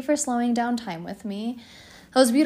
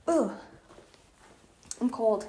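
usual speaking voice

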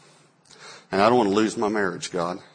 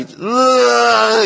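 high, loud wounded little ugh; hurt toddler whimper; 13 semitones higher, 8 dB louder than usual. Retold or imitated. Sensitive son reacting to his father's loud no, hurt whimper. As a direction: yelp like a stung small child. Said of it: "I knew I'd wounded his spirit".

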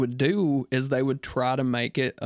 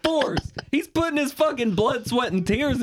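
hyped-up shout; 6 semitones higher, 4 dB louder than usual. Pretend. Hyping the wrestler's promo.

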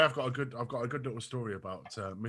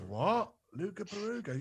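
puzzled; 8 semitones higher, slower than usual. Retold or imitated. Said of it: "And she's gone".

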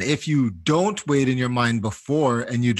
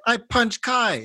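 put-on high-pitched child owning up; unrepentant little kid; 10 semitones higher, slower than usual. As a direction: blurt it high, like a shameless little boy. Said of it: "He's like".

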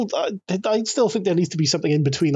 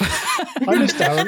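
slow, drawn-out, through laughter; laughing admission; 5 semitones higher, slower than usual. Shown as a demonstration. Host admits missing absent friend on request, slow and laughing. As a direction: say it higher, stretched out and amused. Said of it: "say that you miss Darren".

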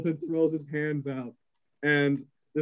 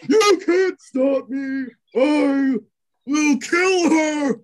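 higher, louder, slow drawn-out threat; villain shout; 12 semitones higher, 8 dB louder, much slower than usual. Acted. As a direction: bellow it like a madwoman mid-fight.

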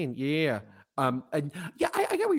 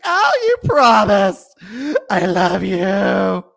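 high, loud, slow hammed-up sob; mock sobbing; 7 semitones higher, 11 dB louder, much slower than usual. Widow at the casket, hammed-up sobbing. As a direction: wail it like a fake-weeping widow. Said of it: "sobs and she's like".

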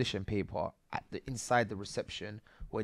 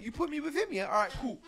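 mock angry retort, much higher in pitch; retold anger; 12 semitones higher than usual. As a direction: replay the moment he lost it, pitched way up. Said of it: "I was angry".

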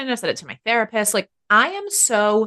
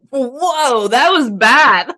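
drawn-out loud whoa, slow and much louder on bad; shocked at old self; 4 semitones higher, 8 dB louder, much slower than usual. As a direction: read your old self with horror. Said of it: "I'm like".

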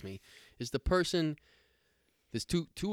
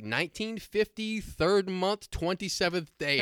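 higher, ponderous recital of a date; mocking birth-date guy; 7 semitones higher, slower than usual. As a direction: mimic an annoying customer reciting his birthday. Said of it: "the guy I hate more than that is the guy".

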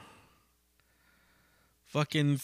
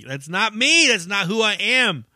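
higher, louder imitation of a complaint; 7 semitones higher, 4 dB louder than usual. Comic complaining to a friend about clean material, imitated protest. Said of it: "in a complaint tone".

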